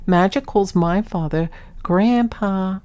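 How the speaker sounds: ordinary talk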